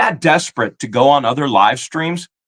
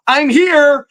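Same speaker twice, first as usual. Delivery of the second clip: high mock announcement; high, sing-song mockery of an unwanted visitor; mimicking someone barging in; mocking a trespasser; 9 semitones higher than usual. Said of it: "and go".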